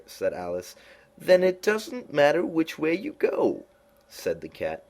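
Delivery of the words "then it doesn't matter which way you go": high, slow, drawn-out cat voice; lofty knowing cat, high and slow; Cheshire Cat voice. A narrator's reading. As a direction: purr it out like a sly grinning cat.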